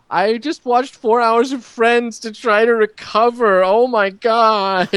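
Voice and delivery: high, slow, laughing despair; wailing through a laugh; distraught coworker. Retold or imitated. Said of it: "he's just texting us, like".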